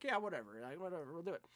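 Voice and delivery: mumbled whatever. Retold or imitated. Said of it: "And Brad's like".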